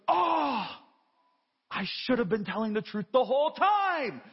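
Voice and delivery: hammed-up child realizing his mistake; repentant cartoon kid. Preacher mimicking a cartoon kid confessing to Bible Man, mock contrite. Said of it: "And the kid goes".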